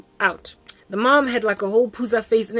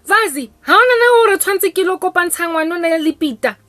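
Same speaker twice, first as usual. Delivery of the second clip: high, loud cooing mother; sing-song matchmaking, much higher and louder; mother picks the girl for her son Peter, high and loud; gushing matchmaker mom; 9 semitones higher, 8 dB louder than usual. Retold or imitated. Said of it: "Vazi is her other son".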